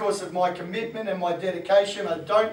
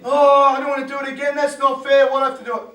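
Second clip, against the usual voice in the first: put-on higher, louder complaining teen; mock whinge at the coach; hammed-up sulky swimmer; whiny athlete; 5 semitones higher, 10 dB louder, much faster than usual.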